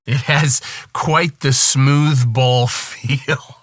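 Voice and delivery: stifling a laugh, slowed; trying to keep a straight face. Real, not acted. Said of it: "I can't say that with a straight face".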